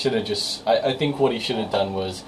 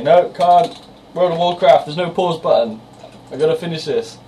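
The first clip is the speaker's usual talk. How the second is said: put-on higher, louder whine of a kid stuck in a game; mock gamer protest; 7 semitones higher, 4 dB louder than usual. Son telling mum he can't come to dinner, lightly whining and higher. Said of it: "I've had to say".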